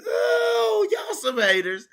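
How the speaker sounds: exclaiming. Real, not acted. Mocking the booing crowd.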